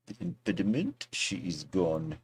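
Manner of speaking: soft, mock French accent. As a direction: put on a light French accent. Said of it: "How's my French accent?".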